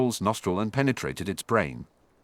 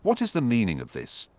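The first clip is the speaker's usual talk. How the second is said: plain reading voice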